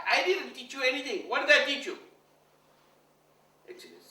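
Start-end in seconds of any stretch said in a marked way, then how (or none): 0.1-2.0 indignant protest. Retold or imitated. Guru indignant.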